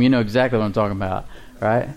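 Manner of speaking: ordinary talk, nothing marked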